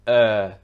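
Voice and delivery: a low, held er without R; vowel for R. Shown as a demonstration. Teacher naming the vowel sound, er with no R. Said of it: "pronounced with a vowel sound instead".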